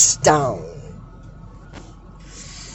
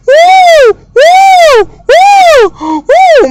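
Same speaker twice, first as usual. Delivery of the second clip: loud, very high repeated whoops; high whooping noise; 5 dB louder, much slower than usual. Acted. Recapper acts out the cartoon moment, high whooping sound effect. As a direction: imitate a siren with shrill woos.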